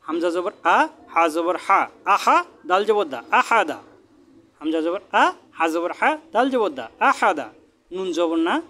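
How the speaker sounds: sing-song recitation, rising on each syllable; deliberate Quran-class chanting cadence; spelling drill. Shown as a demonstration. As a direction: spell it out, then land the joined word with a fall. Spells out joined words as drill.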